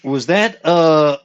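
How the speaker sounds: slow, lightly drawled southern hombre; a hint of cowboy drawl, slowly. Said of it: "I can't do his southern accent".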